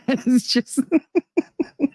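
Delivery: giggling through words; breaking into laughter; laughing. Real, not acted. Laughing mid-sentence.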